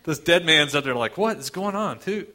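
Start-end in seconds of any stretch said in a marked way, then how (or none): 1.2-2.3 high, mock-bewildered outburst; total confusion. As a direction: blurt it out, completely lost. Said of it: "Total confusion".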